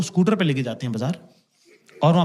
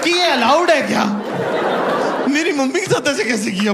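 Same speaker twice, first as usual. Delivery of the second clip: outraged; 7 semitones higher than usual. Real, not acted. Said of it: "बुरा मुझे एक साथ लग गया".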